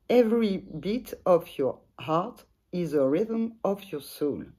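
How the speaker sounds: slow English read with a French accent. Real, not acted. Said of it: "vous excuserez mon, mon accent".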